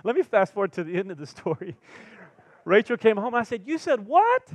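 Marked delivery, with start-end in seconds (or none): 3.6-4.5 high, loud incredulous "what?"; retelling his shocked reply to his wife; shocked disbelief. Retold or imitated. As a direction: react in stunned disbelief. Pastor's shocked reaction retold.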